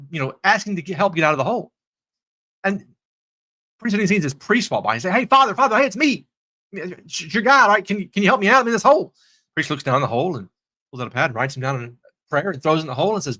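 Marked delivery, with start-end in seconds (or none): 5.1-9.1 high, pleading mock call for attention; acted calling out. Man in a hole calls to passing priest, acted plea. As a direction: call up eagerly to someone overhead.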